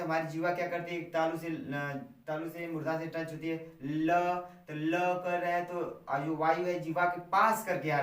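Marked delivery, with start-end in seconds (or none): 3.8-4.3 ल said loud, air passing beside the tongue; lateral l demo. Shown as a demonstration. Teacher demonstrates ल to students. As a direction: let the ल flow along the tongue's sides. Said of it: "यानी बोलेंगे ल".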